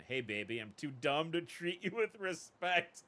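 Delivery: a light mock of a dumb pickup guy, slowed; mocking his voice. Woman mocking the thug's come-on, slow and dumb. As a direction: drag it out like a dim flirt. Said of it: "Hey, I don't talk like that".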